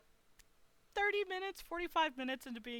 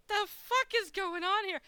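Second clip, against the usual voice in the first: higher, louder, slow disbelief; incredulous outburst; 9 semitones higher, 6 dB louder, slower than usual. Retold or imitated. To the guard blocking her, stunned disbelief. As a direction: spell out each word in shock. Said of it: "I'm like".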